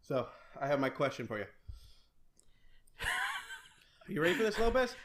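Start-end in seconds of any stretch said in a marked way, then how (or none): 3.0-4.9 laughing, higher teasing challenge. Real, not acted. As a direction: ask it through giggles.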